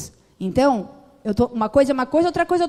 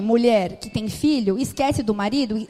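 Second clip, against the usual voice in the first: ordinary talk, nothing marked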